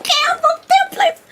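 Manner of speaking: very high, put-on falsetto quote; comic high-pitched voice; mock confession. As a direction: squeal it as a playful aside. Acts out her secret to coworkers.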